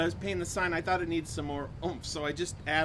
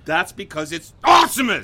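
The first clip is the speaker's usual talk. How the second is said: monster truck ad; 11 dB louder than usual. Acted. Both stuck in the voice.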